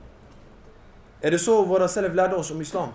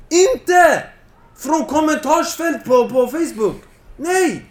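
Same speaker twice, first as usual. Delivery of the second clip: heated outcry; 6 semitones higher, 5 dB louder, slower than usual. Real, not acted. Preacher's heated outburst.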